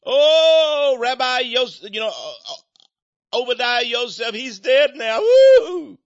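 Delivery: mocking lament ending in a shrill whoop; mock wailing. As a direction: parody a crowd crying in the streets. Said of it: "they moaned in the streets".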